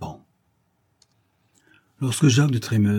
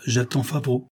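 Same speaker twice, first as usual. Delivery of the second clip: incisive; 3 semitones higher than usual. A narrator's reading. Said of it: "d'une voix incisive".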